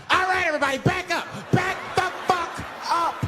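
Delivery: high mock-panicked shouting; flipping out. Cornered man orders passengers back, flipping out. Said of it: "Now the guy flips out".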